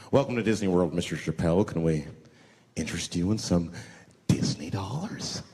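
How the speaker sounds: hotel clerk. Retold or imitated. Hotel clerk greeting the comic, polite. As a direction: offer it like a smooth clerk.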